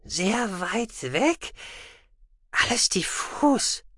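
soft, slow, half-whispered; faint strained murmur. A narrator's reading. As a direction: trail off as if far away in thought. Telepath straining, faint, distant.

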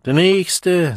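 loud, high summons; calling out. A narrator's reading. Deputy bursar summons the next Delta, calling out. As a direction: call the next person up. Official calls up next in queue.

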